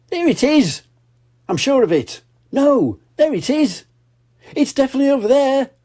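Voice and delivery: high, fast excited shout-out; high giddy Miss Rabbit; excited searching. Acted. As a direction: spot it everywhere, thrilled.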